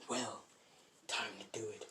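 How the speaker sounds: hushed, near-whispered thought; very soft, low thinking aloud; inner thought. Retold or imitated. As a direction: murmur it privately to yourself. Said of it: "He thinks in his mind".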